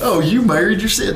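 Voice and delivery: high, mocking Southern twang. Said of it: "brings in the Southern accent".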